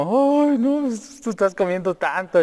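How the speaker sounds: high, fretful imitation of dad; worried dad. Son mimics his father's worry about the taco bill, high and fretful. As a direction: mimic dad's nervous sigh over the cost.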